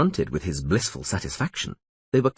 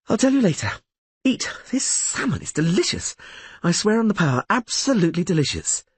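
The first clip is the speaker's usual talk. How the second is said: delighted; 11 semitones higher than usual. A narrator's reading. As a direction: rave about the food. Yennefer delighted, mouth full.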